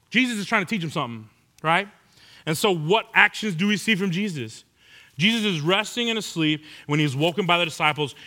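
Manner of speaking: ordinary talk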